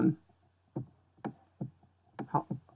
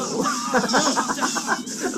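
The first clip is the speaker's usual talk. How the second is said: high, drawn-out giggle; laughing at own pun; 10 semitones higher than usual. Real, not acted. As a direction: crack up at your own bad joke.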